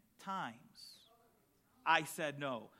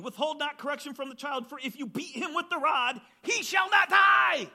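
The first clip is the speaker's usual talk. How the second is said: fervent preached verse; 8 semitones higher, 10 dB louder than usual. Acted. Preacher rereading Proverbs to the congregation, fervent shout. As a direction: preach it hard, climax on the final words.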